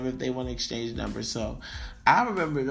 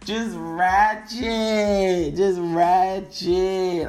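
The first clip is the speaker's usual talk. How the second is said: drawn out; 4 semitones higher, 7 dB louder, much slower than usual. Real, not acted.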